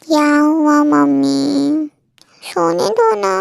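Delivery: put-on slow, drowsy mumble of a just-woken girl; sleepy whine. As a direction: drag the words out half asleep. (नींद में अलसाई आवाज़)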